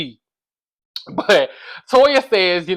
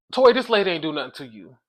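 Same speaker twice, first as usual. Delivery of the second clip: normal talking voice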